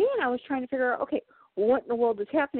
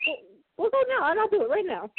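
eager servant; 8 semitones higher than usual. Pretend. Eager offer to clean crypt.

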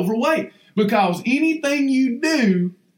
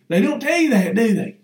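ordinary talk, nothing marked

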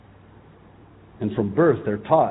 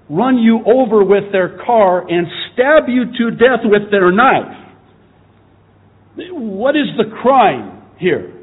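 indignant; 9 semitones higher, 7 dB louder than usual. Real, not acted. Preacher confronts critics of Israel in an outraged, raised voice. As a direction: demand an answer, outraged. Angry rhetorical sermon tirade.

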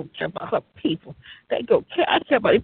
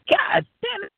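amazed exclamation; 12 semitones higher than usual. Retold or imitated. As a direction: shout it up high like you can't believe your eyes. Exclaiming at track star girlfriend.

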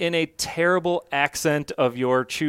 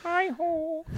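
very high, soft froggy puppet greeting; Kermit the Frog; 6 dB quieter than usual. Shown as a demonstration. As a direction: do a quick high-pitched frog puppet impression. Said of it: "I do a mean Kermit the Frog impression".

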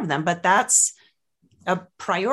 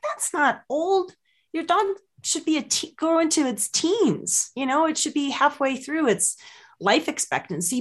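retorting to owner; 8 semitones higher than usual. Retold or imitated. Vet to client about old dog, high disbelieving retort. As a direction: pitch it up in disbelief. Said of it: "I'm like".

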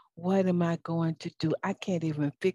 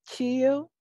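higher, easy-going "Chill"; breezy "chill"; 7 semitones higher, 4 dB louder than usual. Retold or imitated. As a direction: toss off a light reassurance. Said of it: "I told him, I said".

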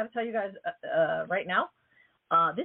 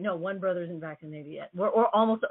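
ordinary delivery

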